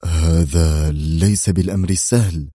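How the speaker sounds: low, strained defence minister; uneasy low-voiced minister; tense deep man. A narrator's reading. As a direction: mutter it, very worried. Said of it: "شديد التوتر".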